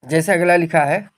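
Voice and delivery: normal talking voice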